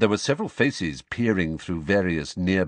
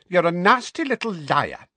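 spiteful aunt; 11 semitones higher, 5 dB louder than usual. Acted. Petunia accuses Harry.

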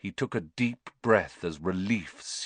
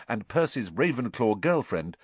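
usual speaking voice

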